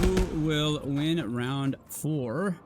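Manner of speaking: slow, drawn-out announcer question; announcer hype. Acted. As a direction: stretch it like a ring announcer.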